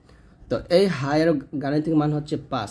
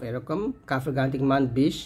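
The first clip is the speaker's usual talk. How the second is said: normal talking voice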